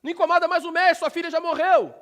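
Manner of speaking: a messenger shouting the news; messenger's shout. Retold or imitated. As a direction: call out the bad news in a rush. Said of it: "o camarada chegou gritando".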